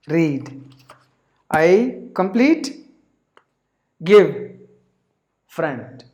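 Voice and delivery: single words, slow, with loud bursts; broken English demo. Shown as a demonstration. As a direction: piece the story together word by word. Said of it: "With the basic vocabulary".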